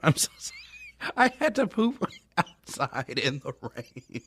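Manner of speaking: breathless embarrassed giggling through the words; giggling confession; laughing. Real, not acted. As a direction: laugh it out, mortified. Embarrassed laughing retelling poop story.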